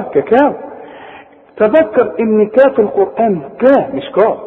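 ordinary talk, nothing marked

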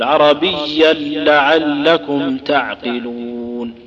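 "عربيا لعلكم تعقلون" بلام مشددة وبطء شديد; أدغم التنوين في اللام ببطء; إدغام التنوين في اللام. Shown as a demonstration. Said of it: "أدغمنا التنوين". (تلاوة مدغمة بتأنٍ)

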